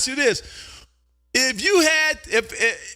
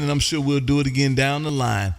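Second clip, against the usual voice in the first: normal talking voice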